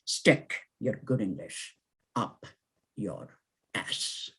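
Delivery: low, very soft, broken by pauses; dying-away refrain. Acted. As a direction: fade out word by word to close the poem. Poem's last refrain dying away.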